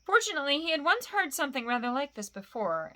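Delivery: ordinary delivery, nothing marked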